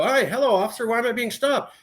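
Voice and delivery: sweet innocent driver act; mock polite self. Plays himself at traffic stop.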